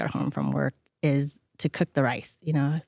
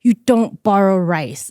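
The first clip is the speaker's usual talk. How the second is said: louder, slow, stern father laying down the rule; dad's stern scolding; 2 semitones higher, 7 dB louder than usual. Retold or imitated. Father scolding daughter over borrowed rice, stern and loud. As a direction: scold her like an offended dad, slow and firm. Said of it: "And he goes".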